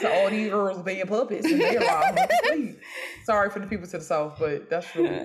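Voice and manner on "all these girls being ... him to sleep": exaggerated country accent. Shown as a demonstration. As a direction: put on a thick country bumpkin accent. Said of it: "my country accent".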